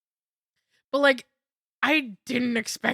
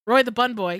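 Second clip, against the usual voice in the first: normal talking voice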